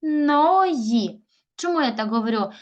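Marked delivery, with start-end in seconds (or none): 0.0-1.2 "Кожної" in slow, raised, separated syllables; split into syllables. Shown as a demonstration. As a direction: break "Кожної" apart, holding each syllable. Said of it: "будемо ділити з вами ці слова".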